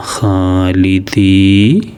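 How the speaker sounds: ഖാലിദീന stretched very slowly; slow model reading. Shown as a demonstration. Teacher shows pupils the joined word, slow and clear. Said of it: "ഖാലിദീന എന്നാണ്".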